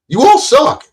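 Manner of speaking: high, loud jeering you all suck; taunting yell. Real, not acted. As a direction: jeer it at them. Taunts YouTubers, then repeats low.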